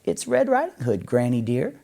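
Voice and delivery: put-on wolf faking a little girl; squeaky little voice. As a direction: falsetto squeak pretending to be the girl. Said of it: "a squeaky little voice said".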